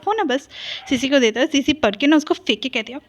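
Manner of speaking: ordinary delivery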